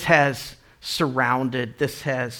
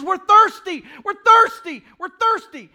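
much higher, louder desperate plea; Moses crying out; 8 dB louder than usual. Retold or imitated. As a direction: cry out to God, begging. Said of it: "He says".

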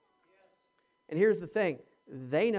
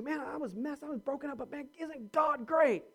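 higher, gushing about being broken yet used; amazed; 7 semitones higher than usual. Shown as a demonstration. Preacher models the humble grateful testimony, amazed. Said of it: "that's what we point to".

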